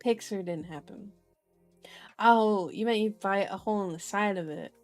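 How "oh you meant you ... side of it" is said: ordinary delivery, nothing marked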